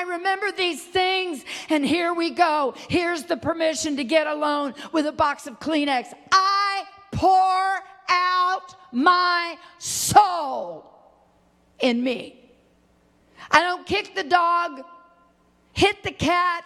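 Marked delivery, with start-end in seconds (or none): 6.3-12.4 each word stretched out very slowly; impassioned scripture cry. Real, not acted. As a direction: cry the verse out, word by drawn-out word. Preacher cries out the psalm verse.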